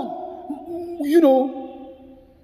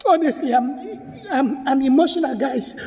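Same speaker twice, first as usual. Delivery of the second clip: usual speaking voice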